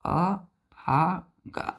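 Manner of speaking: अभागा stretched very slowly, each sound long; very slow demo. Shown as a demonstration. Teacher repeating the Hindi meaning slowly for children. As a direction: draw अभागा out as if dictating. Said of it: "क्या होता है?".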